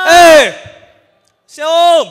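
loud, high hail across the street; calling out. Acted. Customer hailing a motorbike taxi from afar, loud call. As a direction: yell to grab the driver's attention.

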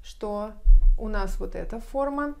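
ordinary talk

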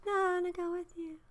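high, quick, half-hearted promise; breezy, insincere yes; Orpah's half-hearted voice. Retold or imitated. Sister-in-law pledging loyalty to Naomi, high and offhand. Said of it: "you can almost hear Orpah's voice going".

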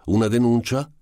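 high, loud challenging question; growing self-assurance. A narrator's reading. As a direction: ask boldly, with rising confidence. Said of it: "sempre più sicuro di sé".

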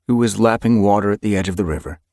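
plain reading voice

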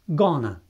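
gonna as two quick syllables, gonə; shortened gonna. Shown as a demonstration. Teacher showing everyday gonna to learners. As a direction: clip going to down to gonna. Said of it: "este pronunțat scurtat".

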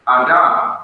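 "Adam" with the second syllable stressed; "Adam" as a-DAM, weight at the end; stress on last syllable. Shown as a demonstration. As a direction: lean on the final syllable of "Adam". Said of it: "the accent was on the last syllable".

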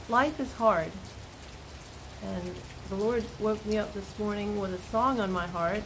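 usual speaking voice

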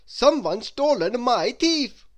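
slow, loud, high-pitched outcry; shouting. A narrator's reading. Mister Topsy-Turvy muddles his report of theft, shouting. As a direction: shout it slowly and emphatically. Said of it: "he shouted".

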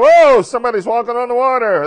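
high, loud startled cry; frightened cry. Acted. Terrified disciples crying out at the figure on the sea, loud. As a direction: yell it out in fright at a ghost. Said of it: "Cried out".

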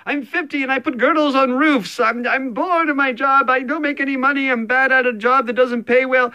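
high, put-on confessional outburst; bored architect's confession. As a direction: blurt it out as the fed-up architect. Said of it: "I hear the word intentionally".

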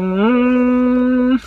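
long steady hummed note; humming. Real, not acted. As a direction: hum to find the starting pitch. Hums to find the note.

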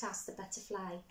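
plain reading voice

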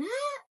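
high sharp mock shriek. Shown as a demonstration. Showing viewers what shouting is not, a mock shriek. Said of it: "no gritan".

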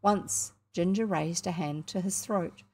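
ordinary delivery, nothing marked

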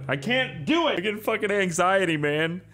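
real nerves, voice jumping up high; anxious outburst. Real, not acted. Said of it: "I'm freaking out".

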